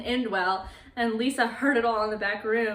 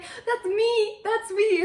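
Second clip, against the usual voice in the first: high, loud, eager claim; imitated eager excitement; 11 semitones higher, 5 dB louder, slower than usual. Monster pointing out his own grave to Lisa, excitedly. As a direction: pitch it way up, insisting happily.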